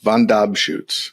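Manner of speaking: Dobschutz said slowly, stress on DOB; name pronunciation. Shown as a demonstration. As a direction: say Von Dobschutz slowly, leaning on the middle syllable. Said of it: "help us pronounce your last name".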